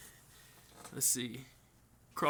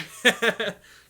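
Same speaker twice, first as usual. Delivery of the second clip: laugh; 7 semitones higher, 10 dB louder than usual. Real, not acted. Laughs at catching up.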